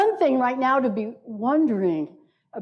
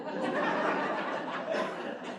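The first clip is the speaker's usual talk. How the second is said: soft laugh; 11 dB quieter than usual. Real, not acted. Joke that trees cause extinction.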